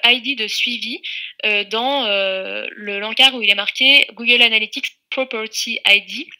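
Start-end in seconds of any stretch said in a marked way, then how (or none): 5.1-6.3 French speaker's attempt at an English accent. Shown as a demonstration. Tutor reading out a field label to learners, English accent. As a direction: read the field name in a put-on English accent. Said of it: "Vous voyez mon super accent anglais".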